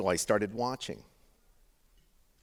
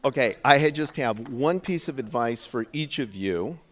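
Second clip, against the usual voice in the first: projecting over the room; loud over crowd; 4 semitones higher, 9 dB louder than usual. Real, not acted. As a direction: raise your voice over laughter.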